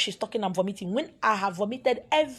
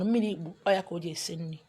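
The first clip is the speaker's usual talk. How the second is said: usual speaking voice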